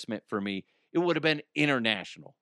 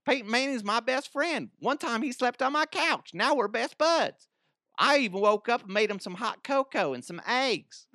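very high, silly mocking caricature; hammed-up high voice making fun of a buddy; Brandon Stokely; 11 semitones higher than usual. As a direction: brag in a ridiculous squeaky mock voice. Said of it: "making fun of him".

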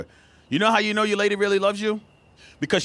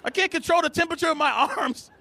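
indignant; 9 semitones higher than usual. Retold or imitated.

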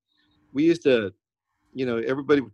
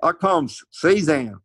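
Arkansas drawl, slow, Cezanne as SIZ-ann; slow Southern Arkansas drawl, Cezanne said SIZ-ann; Arkansas accent; 3 semitones higher, 3 dB louder, slower than usual. Retold or imitated. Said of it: "Like in, in Arkansas".

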